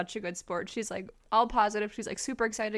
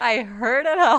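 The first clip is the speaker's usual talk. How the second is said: laughing; 3 semitones higher, 10 dB louder than usual. Real, not acted. Cohost cracks up at the other's tip, laughing. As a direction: crack up while talking.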